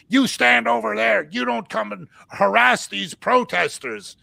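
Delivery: imitating a pushy police officer; retold police order. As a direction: bark it like a cop shoving you back. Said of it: "she grabbed me and she's like".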